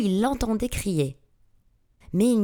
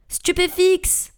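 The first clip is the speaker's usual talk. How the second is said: high, loud, drawn-out spell; long, pitched-up battle cry; cried out; 11 semitones higher, 5 dB louder, slower than usual. A narrator's reading. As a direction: shout the incantation at a fleeing foe. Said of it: "s'écria Harry".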